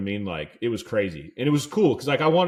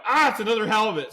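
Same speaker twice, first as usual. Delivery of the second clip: high, loud, delighted cry; catching a second halibut against orders; thrilled; 11 semitones higher, 5 dB louder than usual. Retold or imitated. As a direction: squeal it out, can't believe the luck.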